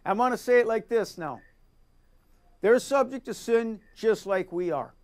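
ordinary talk, nothing marked

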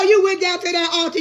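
usual speaking voice

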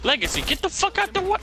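sudden high yell at a teammate; high frustrated yell cut off mid-word. Real, not acted. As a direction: snap out a high-pitched yell to clear the way. Teammate blocking him in match.

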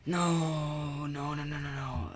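high, loud cry fading out; anguished no. Real, not acted. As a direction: wail it, then trail off. Dies in game.

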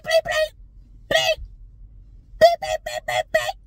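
very high, loud mock cursing fit; exploding in anger. Wife finally snapping after being provoked, shouting bleeps. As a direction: shout self-censored swearing in bursts. Acting out blowing up with censored curses.

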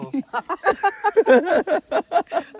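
high, loud cackling fit; real wild, high-pitched laughter; crazy laughing. Real, not acted. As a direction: laugh like you're losing it. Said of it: "अभी पागल होता है".

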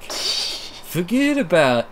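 slow, drawn-out New York "Forget about"; New York accent. Shown as a demonstration. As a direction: stretch it like a New Yorker. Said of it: "my New York".

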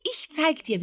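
high-pitched hare voice; eager hare. Acted. Hare offering to show the fox, high-pitched. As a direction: pipe it up invitingly, high.

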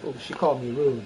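ordinary talk, nothing marked

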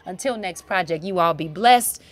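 normal talking voice

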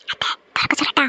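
hushed whisper to the aunt. Acted. Whispering to aunt before the surprise reveal. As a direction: whisper so he won't hear.

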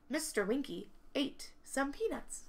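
plain reading voice

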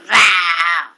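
low growling shout; dragon roar. Pretend. Child playing dragon roar.

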